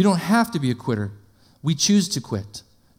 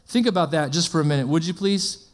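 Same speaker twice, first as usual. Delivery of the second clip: usual speaking voice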